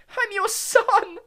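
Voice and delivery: very high, loud, slow scream. Acted. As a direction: scream up at his father. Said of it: "he screamed up at Crouch".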